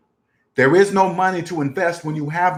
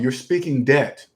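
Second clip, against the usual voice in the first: ordinary delivery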